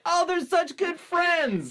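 put-on high, slow, innocent kid; sweetly clueless, drawn out; naive child voice. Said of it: "I was like".

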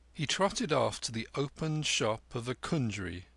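ordinary delivery, nothing marked

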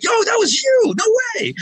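light up with amazed recognition; excited disbelief. Retold or imitated. Band realizes it was him.

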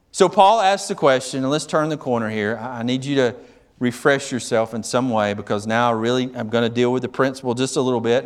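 usual speaking voice

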